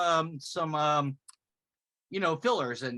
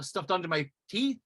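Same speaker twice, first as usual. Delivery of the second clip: normal talking voice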